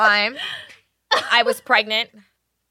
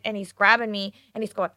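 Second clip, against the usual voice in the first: ordinary talk, nothing marked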